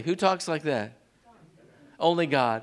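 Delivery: normal talking voice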